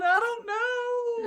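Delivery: put-on high, slow little-girl voice; small child's whimper. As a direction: whine it like a tearful six-year-old. Crying child answers teacher.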